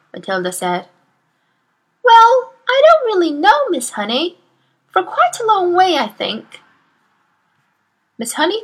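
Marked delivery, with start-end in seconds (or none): 2.0-6.6 put-on high, light small-girl voice, unsure; little girl voice. Matilda answering her teacher in a high small-girl voice. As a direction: play a shy clever five-year-old.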